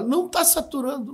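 high urgent call from the ambulance door; retold paramedic shout. Paramedics yelling from the ambulance, high urgent shout. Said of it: "eles gritam de lá".

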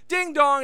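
a bright two-note "ding dong"; imitated doorbell. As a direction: chime it out like a doorbell. Acting out the doorbell.

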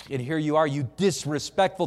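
ordinary delivery

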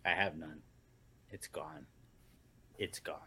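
very low, quiet hopelessness; glum, drained murmur; dejected. Real, not acted. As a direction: give up softly, all faith lost. Has lost all faith.

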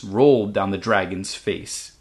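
plain reading voice

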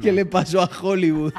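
laughing. Real, not acted. Repite el vídeo riendo.

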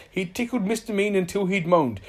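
usual speaking voice

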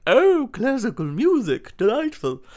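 loud, high-pitched posh gushing; posh wanker voice. Acted. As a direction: hoot it like a posh snob. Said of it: "that's my posh wanker voice".

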